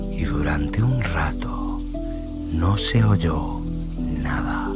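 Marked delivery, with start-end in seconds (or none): none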